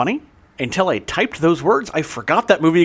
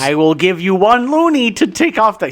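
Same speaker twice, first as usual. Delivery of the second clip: mock Canadian accent; 6 semitones higher, 5 dB louder than usual. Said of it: "I can't even do a Canadian accent".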